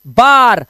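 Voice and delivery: "बार" with a long open "आ", said loud and clear; long aa sound. Shown as a demonstration. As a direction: stretch the "आ" in "बार". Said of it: "ए के बाद आर आ गया तो नियम change". ("बार" में लंबा खुला "आ")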